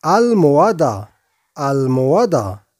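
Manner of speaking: الموادة said as almohada, slowly; Spanish word drilled. Shown as a demonstration. Teacher to viewers, slowly drilling almohada. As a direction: stretch out الموادة clearly. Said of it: "نقول".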